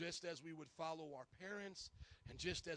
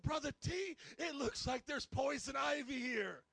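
worried plea; 6 semitones higher, 5 dB louder than usual. Retold or imitated. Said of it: "And then I said".

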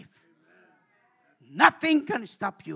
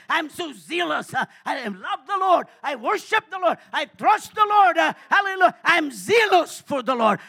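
zealous shout; 5 semitones higher, 8 dB louder than usual. Acted. Zealous believer voiced by preacher.

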